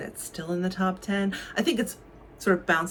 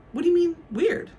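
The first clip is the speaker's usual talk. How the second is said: alarmed; 11 semitones higher, 4 dB louder than usual. Acted. Alarmed Miles.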